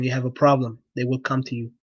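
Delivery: ordinary talk, nothing marked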